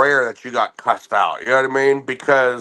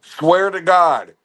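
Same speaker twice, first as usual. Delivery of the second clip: ordinary talk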